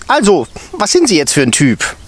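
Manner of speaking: teacher sizing up the intern; stern teacher. A narrator's reading. Stern teacher quizzing the intern.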